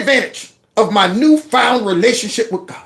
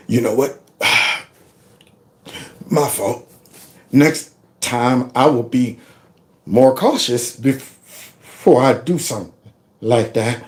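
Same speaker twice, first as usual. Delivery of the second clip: humble apology; 8 semitones lower, 3 dB quieter than usual. Retold or imitated. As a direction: swallow your pride and apologize gently.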